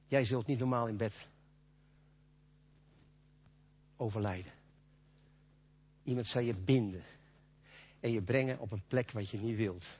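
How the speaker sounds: solemn hush. Acted. Jesus foretells Peter's death.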